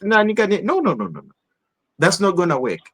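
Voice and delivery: ordinary talk, nothing marked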